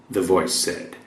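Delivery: normal talking voice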